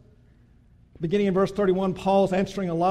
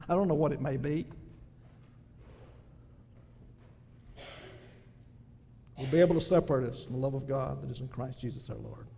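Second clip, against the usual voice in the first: lower, softer after the peak; soft close; 8 semitones lower, 8 dB quieter, faster than usual. Real, not acted. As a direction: let the promise settle gently.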